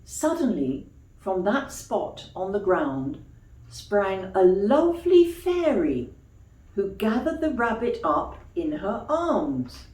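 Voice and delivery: put-on gentle storybook reading for children; story narration. A narrator's reading. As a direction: tell it like a bedtime tale. Reads a bedtime story.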